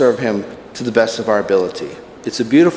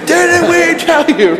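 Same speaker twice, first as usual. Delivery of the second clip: high, slow angry yell; fired-up sergeant; 5 dB louder, slower than usual. Retold or imitated. Sergeant flaring up again at the recruit, high slow yell. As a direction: blow up at the recruit all over again. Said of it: "he started getting fired up again".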